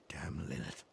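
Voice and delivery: bitter curse under the breath; whispered curse. Acted. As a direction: spit the name in a whisper.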